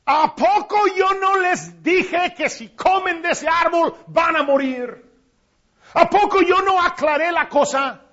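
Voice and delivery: God's mounting indignant reproach; rising indignation. Retold or imitated. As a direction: build the accusation up. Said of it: "Dios diciendo".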